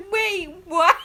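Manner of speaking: high, loud imitation of panic through laughter; laughing mock alarm; mock scared disbelief. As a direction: retell the shock while cracking up. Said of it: "She was so scared".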